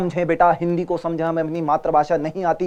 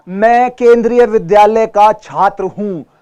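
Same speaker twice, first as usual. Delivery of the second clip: slow, louder, "केंद्रीय" crisply stressed; clear articulation; 3 semitones higher, 9 dB louder, slower than usual. Shown as a demonstration. Teacher modelling a clear school introduction, slow. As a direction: speak it slowly and clearly. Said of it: "साफ, साफ बोले".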